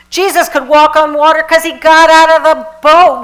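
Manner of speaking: loud, high, fiery sermon cry; Michael Beckwith yelling. Retold or imitated. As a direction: shout it like a fired-up preacher. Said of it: "he's yelling and screaming".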